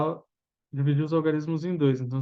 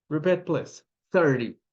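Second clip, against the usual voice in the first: ordinary talk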